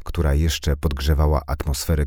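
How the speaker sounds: ordinary delivery, nothing marked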